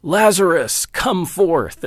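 high, loud call into the tomb; yelling. Retold or imitated. A preacher yelling into the tomb as Jesus did, loud command. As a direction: cry it out as a command to the dead. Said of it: "I yell out what Jesus yelled out".

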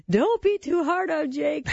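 put-on high, slow, teary little-boy plea; mock tearful pleading for his brother; imitating sobbing son. Mom voicing her sobbing junior-high son, high and slow. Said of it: "And he's sobbing".

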